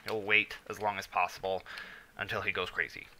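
ordinary delivery

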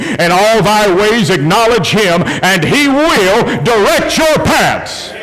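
scripture declared from the pulpit; preached scripture. Acted. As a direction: proclaim the verse, climbing to a drawn-out finish. Recites scripture in preaching style.

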